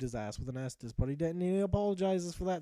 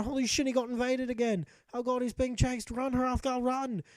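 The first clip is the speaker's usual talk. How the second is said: high, urgent storyteller alarm; mock panic; 7 semitones higher, 4 dB louder than usual. Storyteller urging the fleeing hero on, mock panic. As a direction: play up the alarm in a higher voice. Chased by an invader in the tale.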